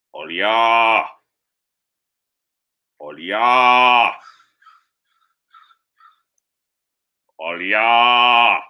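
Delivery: drawn-out mooing Trinidadian moan; whining Trinidadian accent. Acted. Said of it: "constantly whining and complaining".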